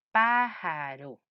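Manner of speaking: "Pájaro" slowly stretched as pá-ja-ro; syllable by syllable. Shown as a demonstration. Teacher looks for the last syllable of pájaro, slowly syllable by syllable. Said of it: "cuál es la última sílaba".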